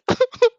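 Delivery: a burst of real high-pitched laughter; sudden high laugh. Real, not acted. Reader cracks up mid-sentence, loud high laugh.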